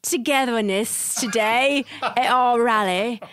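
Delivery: put-on slow, drawn-out broad British accent, laughing; put-on British accent, amused and halting. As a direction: ham up a dirty British accent. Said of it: "give us an accent on the way out".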